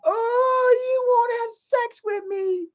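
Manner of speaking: high wailing imitation of her sobbing; hammed-up tearful complaint; mock crying woman. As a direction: wail it like a hurt, crying woman. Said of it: "She's like".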